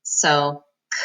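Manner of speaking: usual speaking voice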